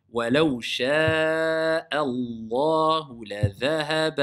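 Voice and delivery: measured chanted recitation of the verse; Quran recitation. Acted. The teacher recites the verse for the class, chanting. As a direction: chant the verse in tajwid style.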